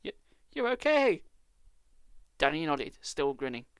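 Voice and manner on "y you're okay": high, loud stammered disbelief; loud relieved exclamation; relieved surprise. Acted. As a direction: stumble over it in shock. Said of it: "uttered a gasp of surprise".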